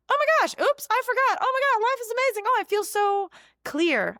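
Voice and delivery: mock blissful person. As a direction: gush like someone on cloud nine. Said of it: "this blissful".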